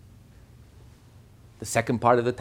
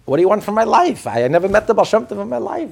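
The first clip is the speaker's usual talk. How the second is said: loud, high-pitched acted protest; exasperated protest; 8 semitones higher, 9 dB louder than usual. Teacher voices the Alter Rebbe protesting to the authorities, exasperated and loud. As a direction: plead no involvement, loud and exasperated.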